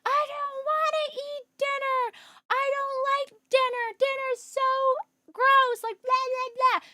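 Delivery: high, drawn-out mock toddler wail; hammed-up little-kid tantrum voice; imitating distraught toddler. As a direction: whine it slowly like an upset two-year-old. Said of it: "Hazel's just absolutely distraught".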